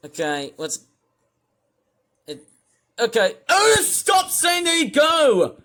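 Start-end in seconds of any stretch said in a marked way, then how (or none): 3.0-5.6 loud angry yell at Otis; shouting. Real, not acted. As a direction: shout it out, exasperated. Yelling at streamer Otis.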